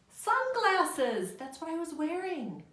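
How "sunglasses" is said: high, loud and excited; blended word. Shown as a demonstration. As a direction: cheer the blended answer. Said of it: "what word are they trying to show us with pictures".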